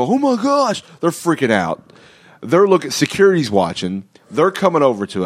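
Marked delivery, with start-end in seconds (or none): 0.0-0.8 much higher, mock-startled exclamation; retold shocked onlookers. Onlookers reacting to the super kick, mock shock. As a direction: play the stunned casino bystanders. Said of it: "These guys see it and go".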